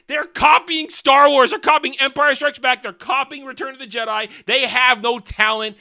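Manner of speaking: loud, high, exasperated accusations; angry rant. Real, not acted. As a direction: hammer each accusation out at full voice. Rants that the writers copy.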